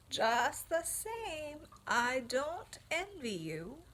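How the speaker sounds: put-on slow knowing old sheep. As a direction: draw it out like a wise old ewe. Old sheep warns Wilbur.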